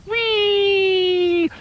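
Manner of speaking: high, long sliding squeal; sliding whee. Acted. Duck going down the beanstalk slide, squealing.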